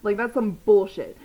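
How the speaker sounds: loud indignant retort to the cop; retold telling off. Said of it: "And I was like".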